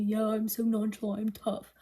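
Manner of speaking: lightly voiced mock tough guy, a hint of swagger. Mocking Florian's tough-guy front for viewers, lightly voiced swagger. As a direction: tease his cool act with a touch of bravado. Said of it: "that tough guy type of thing".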